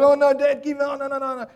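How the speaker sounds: put-on high-pitched, loud pleading kid voice; kids pleading in wrestling. Kid pinned by dad begs him to stop, high pleading. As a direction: squeal like a pinned child begging. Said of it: "they're like".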